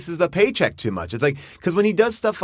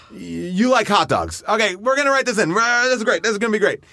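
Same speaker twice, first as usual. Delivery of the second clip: higher, rushing this is great; excited writer; 7 semitones higher than usual. Retold or imitated. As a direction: pitch ideas with rising excitement.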